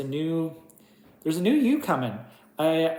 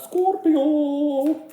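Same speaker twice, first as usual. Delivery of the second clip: Scorpio squealed much higher and louder; 12 semitones higher, 7 dB louder than usual. Shown as a demonstration. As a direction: Scorpio in a high, playful, sing-song call. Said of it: "I wanted to say it like that".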